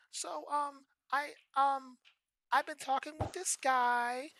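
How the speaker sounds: put-on high, slow, hesitant girlfriend; girlfriend's voice. Girlfriend confessing about another guy, high and hesitant. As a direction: drag out the confession.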